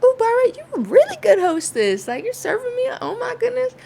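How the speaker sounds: high, loud gushing thanks to the hostess; thrilled guest gushing to Barbara; gushing praise. Retold or imitated. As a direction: gush over her like she's royalty.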